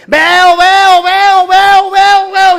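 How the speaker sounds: very high, very loud repeated cries; ecstatic shouting. Retold or imitated. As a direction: shout it over and over like a miracle just happened. Ciego curado grita que ve. (gritos eufóricos, muy agudos y fuertes)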